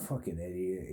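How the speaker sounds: low muttered fucking idiot, under breath; muttered insult. Retold or imitated. Guy muttering at him as he walks out, quietly. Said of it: "this guy's like".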